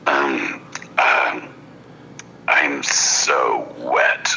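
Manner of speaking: low, slow breathy whisper of a hypnotized woman; imitating her deep gravelly whisper. Hypnotist imitating the dazed girlfriend in a low, slow whisper. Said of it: "her voice is really deep at this point, like, kinda gravelly".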